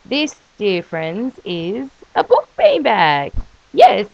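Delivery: slow grand presenter voice; mock ad pitch. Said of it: "presenting product like an ad".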